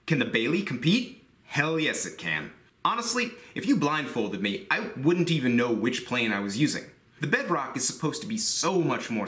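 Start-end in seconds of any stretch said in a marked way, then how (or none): none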